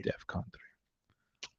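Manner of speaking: unvoiced whisper of defcon three. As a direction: breathe the words defcon three with no voice. Repeated in a whisper.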